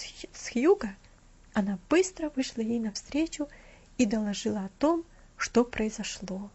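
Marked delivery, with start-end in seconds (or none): none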